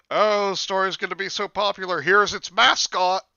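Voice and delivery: high, loud gushing author; mock excitement. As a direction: gush like an overexcited author, loudly. Mimicking an over-hopeful author.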